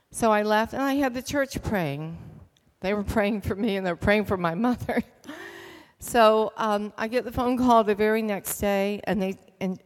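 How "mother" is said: usual speaking voice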